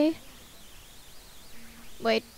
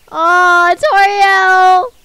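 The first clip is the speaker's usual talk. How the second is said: loud, higher cry of her name; anguished cry; 7 semitones higher, 12 dB louder, much slower than usual. Real, not acted. Player crying out as Toriel walks away, loud and high. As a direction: call out in dismay.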